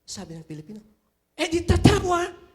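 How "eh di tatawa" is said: much higher, louder naive answer; eager Filipino answer. Retold or imitated. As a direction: blurt it out proudly like the clueless applicant. Filipino answers the translated question.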